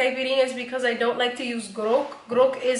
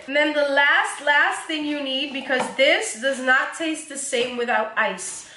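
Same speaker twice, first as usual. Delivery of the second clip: normal talking voice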